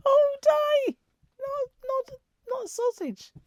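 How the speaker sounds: very high, squeaky exclamation; comic high-pitched protest; mock outrage. Real, not acted. Mock-astonished emphasis on not eating.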